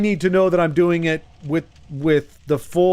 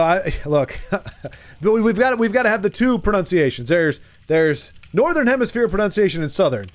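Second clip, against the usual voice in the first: ordinary delivery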